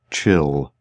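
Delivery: a low, quiet rumble behind her; a stranger calming her in the dark with a low rumble; deep low rumble. Acted. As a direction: drop the voice into a soft rumble. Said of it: "The deep, low voice rumbled behind her so quietly".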